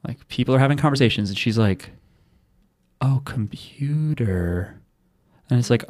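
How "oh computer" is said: slow, soft, dreamy imitation; a mock-dreamy sigh to the computer; spacey drawl. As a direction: lightly play the spaced-out girl.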